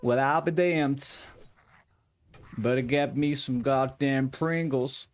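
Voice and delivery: put-on slow, drawn-out Southern accent; a light, slow Texan accent. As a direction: put on a lazy Southern drawl. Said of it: "They make you talk more Southern".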